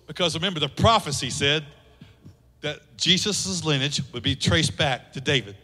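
ordinary talk, nothing marked

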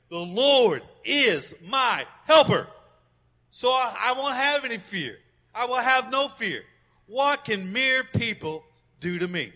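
loud, slow scripture proclamation; preaching at full force. Acted. Pastor proclaiming Hebrews to the congregation, loud. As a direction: proclaim the verse with bold faith.

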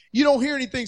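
normal talking voice

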